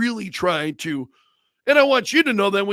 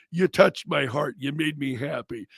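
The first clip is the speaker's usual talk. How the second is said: normal talking voice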